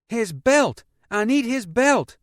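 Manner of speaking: high, loud urgent demand; urgent shout. A narrator's reading. Mother driving one-handed, urgent shout. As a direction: insist on it over the noise.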